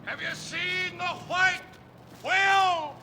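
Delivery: high, strained distant shouting; calling across the sea. Acted. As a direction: hail the other captain. Shouting between ships at sea.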